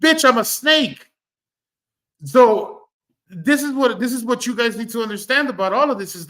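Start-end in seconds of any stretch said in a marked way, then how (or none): 0.0-1.1 high, loud, slow mock snake retort; sassy snake. Snake answering the lady it bit, high loud smug punchline. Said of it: "the snake says".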